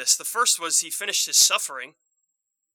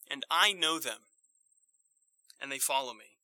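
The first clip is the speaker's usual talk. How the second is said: tender quote; 4 semitones lower, 7 dB quieter than usual. Acted. Quoting Jesus tenderly in sermon.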